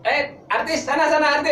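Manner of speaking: high, loud joking command; mock bossy director. Host jokingly plays director ordering an artist aside, mock bossy. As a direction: call out like a pretend bossy director. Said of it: "Ini kan seharusnya sutradara".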